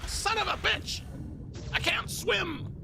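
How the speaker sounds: high shrieking curse, then a wail; panicked yelp. Real, not acted. Gamer panicking as character hits water, high yelp.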